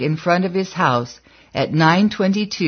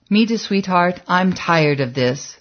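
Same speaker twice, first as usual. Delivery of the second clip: ordinary delivery, nothing marked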